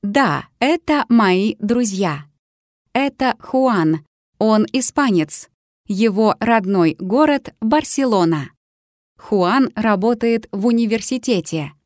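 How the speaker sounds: ordinary talk, nothing marked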